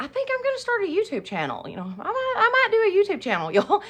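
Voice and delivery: high, fast, airy joking voice; tossing off a playful idea; joking self. Retold or imitated. To her kids, half joking, high and quick. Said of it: "and I'm like".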